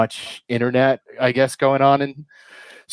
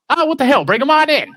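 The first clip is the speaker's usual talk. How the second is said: high, loud cartoon lecher giving in; Quagmire; 13 semitones higher, 5 dB louder than usual. Neighbour giving in on babysitting, high loud cartoon impression. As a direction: throw caution away in a much higher voice. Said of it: "he's like".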